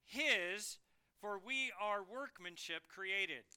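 "His" punched first and loud, then slow; stress on His. Shown as a demonstration. Preacher reading the Greek order, "His" stressed loud first. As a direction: hit the word "His" hard and lead with it. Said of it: "it literally reads this way".

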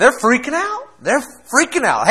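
high, panicky cry; a preacher acting out panic, high pitched; freaking out. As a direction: play the frantic disciples in the storm. Preacher voicing panicked disciples.

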